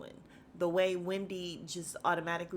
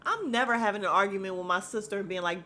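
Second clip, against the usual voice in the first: ordinary talk, nothing marked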